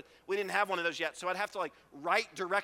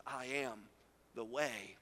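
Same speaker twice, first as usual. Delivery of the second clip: hushed reverent; 7 semitones lower, 8 dB quieter, slower than usual. Acted. Jesus stands before him.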